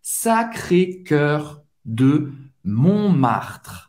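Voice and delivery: Sacré-Cœur de Montmartre slow with rolled-back French R; slow pronunciation drill; tongue-twister practice. Shown as a demonstration. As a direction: drill the R slowly. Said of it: "trava-língua pra você treinar esse R".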